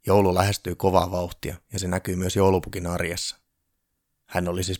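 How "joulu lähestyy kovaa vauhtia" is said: ordinary delivery, nothing marked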